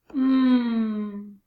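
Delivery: sustained hummed "mmm"; letter sound demo. Shown as a demonstration. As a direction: hold the M sound as one long hum. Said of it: "I'm gonna give a sound".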